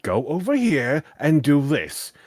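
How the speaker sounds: mock bossy order, slow and loud; rude command. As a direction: bark it like a pushy teammate giving orders.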